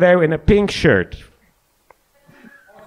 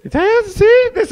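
excited. Real, not acted. Excited told-you-so outburst.

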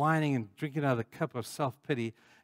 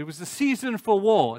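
higher, louder, stress on "season"; emphatic quote; 6 semitones higher, 5 dB louder than usual. Real, not acted. Preacher quoting scripture about David, emphatic. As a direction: punch "season" high and hard.

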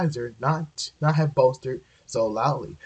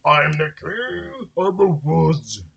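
slow, louder bellow of a proud moose; bellowing bull moose; 3 semitones higher, 4 dB louder, slower than usual. A narrator's reading. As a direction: boom it out like a huge bull moose. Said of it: "bellowed the bull moose".

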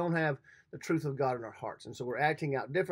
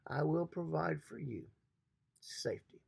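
soft promise; 8 dB quieter than usual. Acted. God's promise voiced softly.

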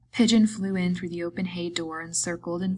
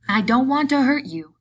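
high, loud, upset protest; raised, anxious voice; distressed pleading; 7 semitones higher, 10 dB louder than usual. A narrator's reading. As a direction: insist in childlike distress. Lenny distressed over still body.

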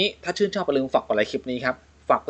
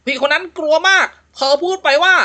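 raised storytelling voice, spooky build; ghost story narration; 9 semitones higher, 4 dB louder than usual. A narrator's reading. Host reads a ghost joke to co-host, storytelling voice. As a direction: tell it like a campfire ghost tale.

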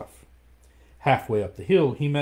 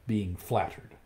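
plain reading voice

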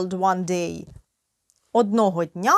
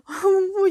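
short laugh; 10 semitones higher than usual. Real, not acted. Tired teacher laughs at herself.

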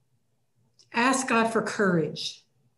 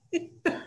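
laugh; 8 semitones higher, 4 dB quieter than usual. Real, not acted. Laugh after the retort.

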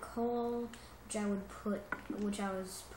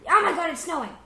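much louder, higher shout of surprise; excited shout; 8 semitones higher, 13 dB louder, faster than usual. Real, not acted. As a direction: yelp at the snow. Sees snow in game.

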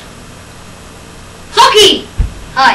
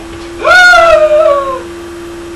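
high shocked gasp; 11 semitones higher than usual. Acted.

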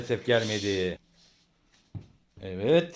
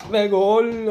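high, loud goal call; screaming football commentator; 8 semitones higher, 4 dB louder than usual. Acted. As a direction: belt out the goal. Dad commentating home football.

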